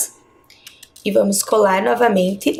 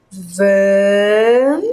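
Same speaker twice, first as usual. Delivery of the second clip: "Van" held long as a single syllable; isolated syllable; 3 semitones lower, 3 dB louder than usual. Shown as a demonstration. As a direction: draw out "Van" slowly, sounding it out. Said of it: "vamos cortar aqui".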